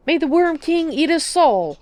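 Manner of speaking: sudden high, loud, slow curse; vicious cry. A narrator's reading. Old man suddenly cursing the Archmagister, vicious and loud. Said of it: "cried the old man quite suddenly and very viciously".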